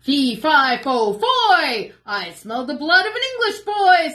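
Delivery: booming storybook giant; loud giant chant. Acted. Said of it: "said the giant".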